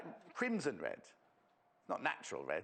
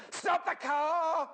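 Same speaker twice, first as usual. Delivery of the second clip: much higher, louder, slow mock yell; sudden yell; 14 semitones higher, 7 dB louder, much slower than usual. Imitating Jay yelling at the driver to stop. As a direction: bellow it like an urgent passenger. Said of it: "And then he went".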